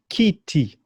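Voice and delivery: "kitty" with ee vowels, kee-tee; Swahili i vowel. Shown as a demonstration. Teacher modelling Swahili word for chair, ee sounds. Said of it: "Can you pronounce that word?".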